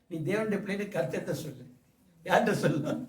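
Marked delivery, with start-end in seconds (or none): none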